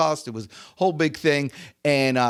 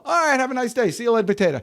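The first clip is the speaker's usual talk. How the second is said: higher, chirpy goodbye; breezy mock sign-off; 7 semitones higher, much faster than usual. Coach shrugs off a refusing prospect, breezy sign-off. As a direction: toss off a carefree farewell. Cheerful brush-off ending imagined call.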